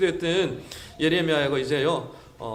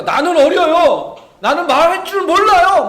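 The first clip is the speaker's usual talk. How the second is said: higher, louder pleading; childish whine; 9 semitones higher, 10 dB louder, slower than usual. Retold or imitated. Jeremiah pleading with God, childish whine. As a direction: wail it like a kid who's too young. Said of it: "막 그렇게 얘기할 때".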